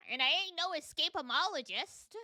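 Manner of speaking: lightly put-on Southern accent, folksy; silly southern accent. Landlady warning the detectives, in a silly Southern accent. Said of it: "the silly southern accent".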